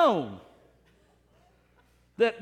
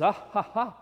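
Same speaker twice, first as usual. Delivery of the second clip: chuckle. Acted. Saint Peter chuckles at preacher.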